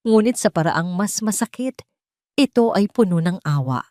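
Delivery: usual speaking voice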